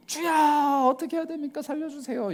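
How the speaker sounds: high pleading cry that fades; wailing "살려주세요" high and breaking off; desperate prayer. Retold or imitated. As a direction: beg God for rescue, pitch way up. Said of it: "이렇게 보통 하죠".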